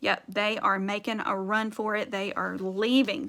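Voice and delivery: plain reading voice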